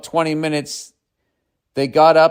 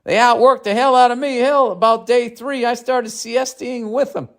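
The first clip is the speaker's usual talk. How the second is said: Southern accent; 11 semitones higher than usual. Shown as a demonstration. Redoing the punchline for the audience in Southern accent. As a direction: redo the punchline as Russell, twangy and pitched up. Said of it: "I should have been doing the Southern accent".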